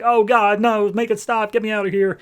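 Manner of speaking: high-pitched panicked pleading, acted out; mock freak-out. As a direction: imitate someone begging in panic to escape. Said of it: "Anguish immediately starts freaking out".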